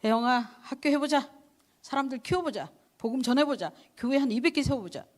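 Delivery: high, loud rallying call; God as a loud taskmaster, imagined; grand mock command. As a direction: boom it like a pushy commander. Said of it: "그렇게 하셨으면".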